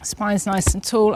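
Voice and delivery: usual speaking voice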